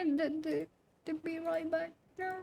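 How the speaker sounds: soft, high, fake-tearful voice; mock crying. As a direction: pretend to weep as you say goodbye. Mock sad goodbye to chat.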